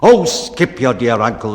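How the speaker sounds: loud, irritated cut-off; angry exclamation. Acted. Bellman angrily cutting off the baker's uncle story, loud and cross. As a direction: brush the uncle aside crossly. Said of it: "the bellman exclaimed as he angrily".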